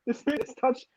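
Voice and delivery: ordinary talk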